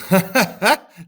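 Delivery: loud, high staccato laugh; villainous king's laugh, hammed up; mocking laugh. Agamemnon laughs off Achilles' demand, mocking laugh.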